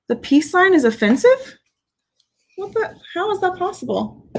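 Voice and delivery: high-pitched, baffled question; taken aback, voice climbing high; incredulous. Real, not acted. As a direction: rise sharply in disbelief at what you just read. Reacts in disbelief to message.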